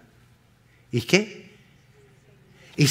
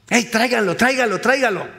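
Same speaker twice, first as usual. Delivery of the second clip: high, quick summons; urgent acted calling; 7 semitones higher, faster than usual. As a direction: call over a crowd to fetch someone. Acts out calling for blind man.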